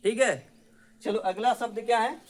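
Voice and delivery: usual speaking voice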